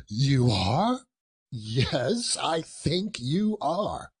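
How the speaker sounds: rising query, then slow, high agreement; mock severity. A narrator's reading. Boss confronting his late clerk, mock severe. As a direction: draw it out with pretended sternness.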